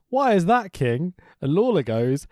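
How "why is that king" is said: mock-sarcastic setup question; higher, exaggerated feed line to a partner. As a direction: imitate a commentator asking sarcastically. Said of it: "J R responds sarcastically".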